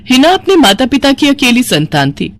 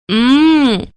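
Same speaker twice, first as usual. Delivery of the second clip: long, high savouring "mmm"; appreciative hum; 4 semitones higher, much slower than usual. Acted. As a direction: hum with relish at a good smell. Daughter savouring the smell of food. (चटखारे भरी हम्म)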